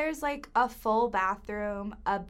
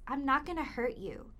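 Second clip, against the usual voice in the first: normal talking voice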